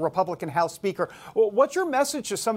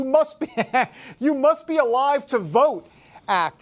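"You Must Be Alive to Vote Act" said higher and louder, breaking into a laugh on "Must"; laughing through it; 6 semitones higher, 4 dB louder than usual. Real, not acted. Host cracking up at an absurd bill name, laughing as he reads it. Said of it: "you're gonna love this".

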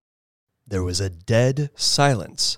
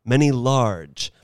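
usual speaking voice

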